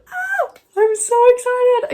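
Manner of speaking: very high, loud, squealing joy; excited squeal. Real, not acted. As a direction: squeal with real glee. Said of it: "I'm just really excited".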